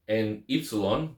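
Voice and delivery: normal talking voice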